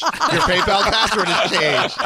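husband laughing at the notifications. Retold or imitated. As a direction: laugh as you read the alert. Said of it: "I kept getting notifications like".

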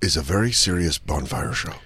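mock movie villain. Friend parodying a ransom villain to the host, low and menacing.

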